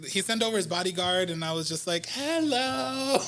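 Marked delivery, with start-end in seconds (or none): 2.1-3.2 high, drawn-out tipsy greeting; drunk singsong hello. Retold or imitated. Drunk singer greeting a celebrity's bodyguard, high singsong hello. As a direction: call it out high and giddy.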